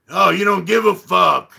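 acted-out angry comeback; imitated irate retort. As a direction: mimic an affronted neighbour raising his voice. Acting out irate neighbour's retort.

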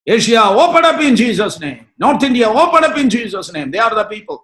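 slow, loud, high proclamation; fervent shouted command in prayer; full-force preaching. Real, not acted. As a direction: cry out over the nations like a revival preacher.

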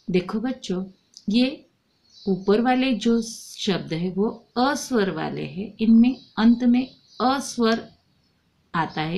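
ordinary talk

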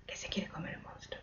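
unvoiced, fast whisper; teacher whispering a quiz question to the class. Shown as a demonstration. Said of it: "whispered question to class".

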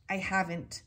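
ordinary delivery, nothing marked

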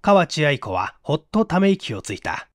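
ordinary delivery, nothing marked

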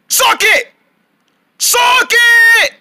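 high, insistent shout; rising, frustrated yell of a name; exasperated calling. Acted. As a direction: call out to someone ignoring you. Calling friend who ignores him.

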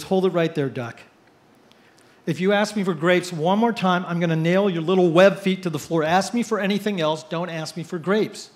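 angry manager. Retold or imitated. Store manager warning a pestering duck, angry. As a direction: lay down the law, slowing at the end.

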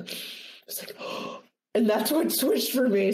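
a quick startled inhale; imitated sharp gasp. A woman in the film gasping at a smell. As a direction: gasp in mock surprise. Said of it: "she would just go".

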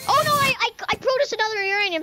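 sudden high panicked yelp; startled. Real, not acted. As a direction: blurt it out in shock. Accidentally makes unwanted uranium again.

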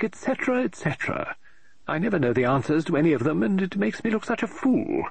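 high, fussy woman's voice, rising in exasperation; an eccentric lady author tearing her hair; fretting novelist. Acted. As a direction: novelist complaining aloud about her plot, high and exasperated. Mrs Oliver rattles off complaints.

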